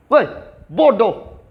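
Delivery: short mock outburst; mock angry yell. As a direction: snap it like a rude customer. Example of a customer yelling.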